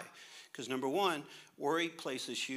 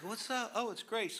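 higher, lightly voiced family; mock family calling out; imitating shouting family; 8 semitones higher than usual. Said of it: "like a mosquito got in the room".

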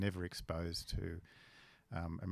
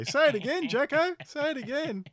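much higher and louder, a put-on broad Australian voice; mock country-boy cheer, pitched way up; imitating a broad Aussie schoolmate; 11 dB louder than usual. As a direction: crow it out like an excited country kid. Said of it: "And he used to say".

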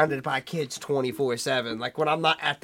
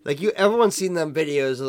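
ordinary talk, nothing marked

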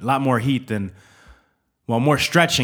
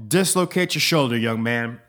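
usual speaking voice

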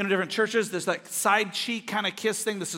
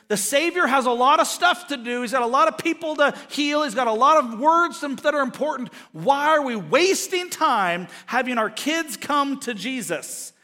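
raised, higher-pitched indignant rebuke; exasperated mock telling-off, building then dropping on the last word; scolding; 4 semitones higher, 3 dB louder than usual. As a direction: scold the parents for wasting his time. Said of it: "scolded the parents for bothering Jesus".

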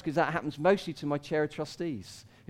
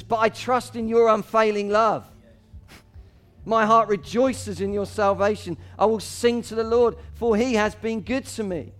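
praise; 5 semitones higher, 6 dB louder than usual. Acted. Psalm turns to praise.

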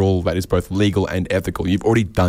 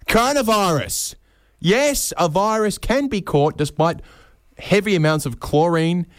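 sarcastic high; 9 semitones higher than usual. Acted.

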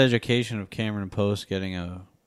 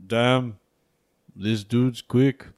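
slow, drawn-out badly faked Austrian action-hero voice; Arnold impression; much slower than usual. Podcaster retries the Arnold line for cohosts, slow bad impression. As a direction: stretch each word like a clumsy Arnold. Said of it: "Or more like".

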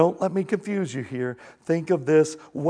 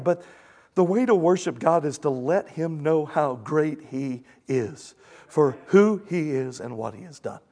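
ordinary delivery

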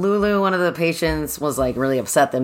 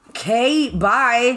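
mock brush-off; 8 semitones higher, much slower than usual. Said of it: "she's like".

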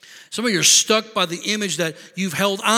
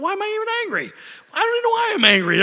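mock exasperation; 4 dB louder, much faster than usual. Mock frustrated self.

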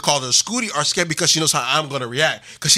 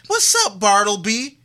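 put-on girly greeting; 6 semitones higher than usual. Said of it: "and said".

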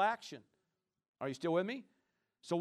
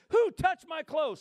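higher, louder and slow "Who touched my clothes?"; urgent question; 10 semitones higher, 8 dB louder, slower than usual. Retold or imitated. Jesus turning in the crowd, asking loudly and slowly. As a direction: call it out slowly over the crowd. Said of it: "turned around in the crowd and said".

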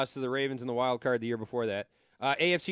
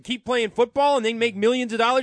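higher, louder, disgusted disbelief; outraged rant; 8 semitones higher, 6 dB louder than usual. Real, not acted. Host fuming at the broadcaster's remarks, high and loud outrage. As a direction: tear into his words with scorn.

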